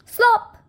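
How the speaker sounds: high, loud "Slop!" sound effect; splashy slop. A narrator's reading. Reading to kids, the bucket lands: a high, loud "Slop!". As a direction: pop it out like a splash.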